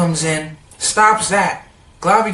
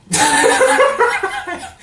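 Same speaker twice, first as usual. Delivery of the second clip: high breathless squeal; a quick high yelp of disgust; mock shriek. Neat freak shrieking when his things get bumped, high yelp.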